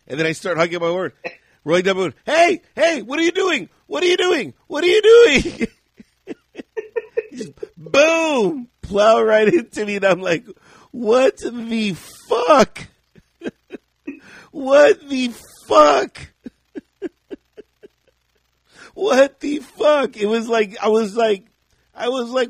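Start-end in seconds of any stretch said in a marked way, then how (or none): 2.3-5.7 high, loud alarmed yelling; panicked repeated yelling; calling out. Retold or imitated. Yelling at car backing into him. 10.9-16.3 slow, high, loud disbelieving shout; high drawn-out shout of shock; stunned yelling. Retold or imitated. Car plows into him. 18.9-20.2 disbelief. Retold or imitated. Repeating his shock.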